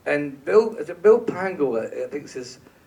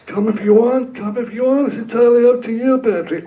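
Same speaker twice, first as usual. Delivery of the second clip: loud, higher, fast mock gangster director; scary Brian voice; 8 semitones higher, 10 dB louder than usual. Director telling Patrick it's up to him, loud mock menace. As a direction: bark it out like a hammed-up mobster. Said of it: "it's quite scary, really".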